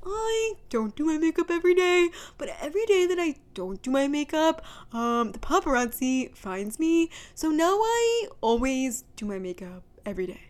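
drawling influencer impression; stretched vowels, slightly high, leaving gaps between phrases. Co-host showing off an influencer impression, drawled put-on voice. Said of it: "I can do a really good [name] impression".

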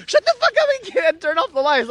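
high, irritated mock yell; angry outburst. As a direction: imitate an annoyed sleeper snapping awake. Imitates angry woken sleeper.

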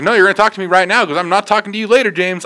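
fast, high, insistent argument; retold angry retort. Deli worker refusing manager's put-off, fast heated retort. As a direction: argue back in one quick rush.

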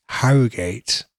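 Harrogate said in a mock posh voice, set apart from the plain version before it; posh pronunciation. Shown as a demonstration. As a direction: repeat Harrogate as if putting on airs. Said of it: "Nice and posh".

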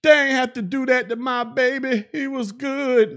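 high-pitched protesting mother; mock mama's high wail of protest; mama on the news. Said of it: "then his mama on the news".

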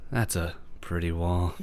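low, soft dramatic read; melodramatic. Acted. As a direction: sigh first, then say it with hushed drama. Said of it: "like, more melodramatic".

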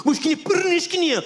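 high fake Russian babble; mock Russian gibberish. Comedian joining in scolding Igor, fake Russian babble. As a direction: spit out pretend Russian sounds. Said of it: "Anch'io, Igor".

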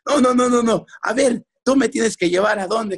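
high-pitched insistent refusal; angry father. Retold or imitated. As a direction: imitate dad laying down the law. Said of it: "imagínate a mi padre enojado".

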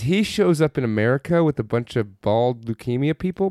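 ordinary delivery

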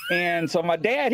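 ordinary delivery

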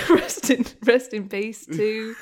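real giggles breaking into a solemn tribute; laughing. Real, not acted. Podcast guest paying tribute to a late comedian, laughing. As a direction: try to pay respects but crack up laughing. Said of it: "Don't know why you're laughing".

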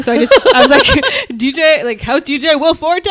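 high, loud, laughing excitement; retold giddy shout; excited. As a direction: gush like you just spotted a celebrity. Excited at recognising a celebrity.

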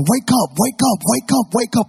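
high, loud, urgent acted cries; panicked shouting. Passenger shaking his passed-out pilot friend, panicked shouting. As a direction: shout as if shaking someone out of a faint. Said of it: "started shaking his friend and saying".

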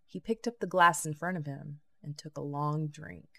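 ordinary delivery, nothing marked

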